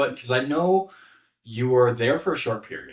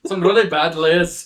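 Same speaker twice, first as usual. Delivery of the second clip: loud, raised-pitch ogre impression; Shrek; 6 semitones higher, 7 dB louder than usual. Co-host riffing on the onion joke, loud ogre voice. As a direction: keep the ogre bit going, loudly. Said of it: "And then Shrek's just like".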